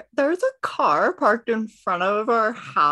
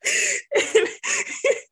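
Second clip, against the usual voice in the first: high breathless laugh. Real, not acted. Laughter after shouted address.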